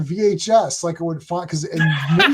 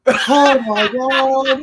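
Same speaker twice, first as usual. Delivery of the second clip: drawn-out, higher "oh my God"; mock exasperated groan of disbelief, very slow; retold exasperation; 6 semitones higher, much slower than usual. Acted exasperation at her mistake.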